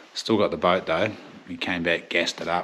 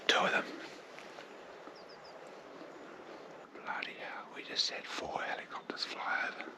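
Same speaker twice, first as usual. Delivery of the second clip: hushed hunter's whisper; 11 dB quieter than usual. Real, not acted. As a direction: whisper so the deer won't hear. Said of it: "hunting near stags, whispering".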